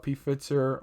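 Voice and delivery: "P Pfizer" said as pee-FITS-er; "P Pfizer" with a short i and a ts sound; alternative pronunciation. Real, not acted. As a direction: say "P Pfizer" with a spelt-out P, then "fitser". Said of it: "however you wanna go ahead and, uh, pronounce it".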